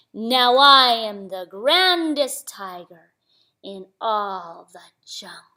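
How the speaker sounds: very slow, grand boast, loud then falling away; boastful tiger. Acted. Tiger going away in purple shoes, slow swaggering boast. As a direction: stretch GRANDEST out proudly.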